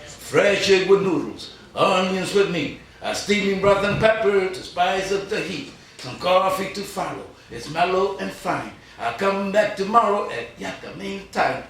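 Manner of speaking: rhythmic, sing-song poetry reading; a cheerful diner ode, read with a swing; poem recital. Acted. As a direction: lay the rhymes out with a steady beat.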